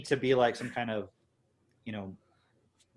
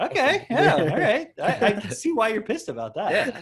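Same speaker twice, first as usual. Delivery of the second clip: high, loud, sheepish agreement; mock agreeing; 10 semitones higher, 8 dB louder than usual. Visitor agreeing that the anger makes sense. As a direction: concede like a caught-off-guard friend. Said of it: "And you're like".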